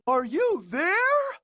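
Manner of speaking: high, loud, slow call. Acted. Horton calling into the clovers, loud. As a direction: call out searching.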